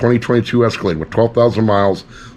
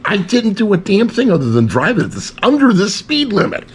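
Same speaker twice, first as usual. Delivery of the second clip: high-pitched, exasperated denial; playfully outraged self-defence; indignant protest; 9 semitones higher than usual. Real, not acted. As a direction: protest your innocence, pitch climbing. Protesting blame for the breakdown.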